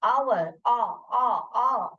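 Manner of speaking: "Our" with its opening आ sound drawn out; sound demo. Shown as a demonstration. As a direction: stretch the आ of "Our". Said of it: "ओ का sound आ रहा है". ("Our" की आ ध्वनि खींचकर)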